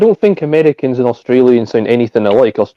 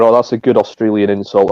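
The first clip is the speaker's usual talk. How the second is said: normal talking voice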